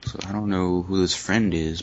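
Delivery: normal talking voice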